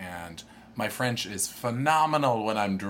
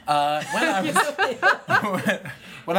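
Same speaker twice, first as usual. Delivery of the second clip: laughing through the words; giggling as he starts; 12 semitones higher, 9 dB louder, slower than usual. Real, not acted. Laughing while starting story.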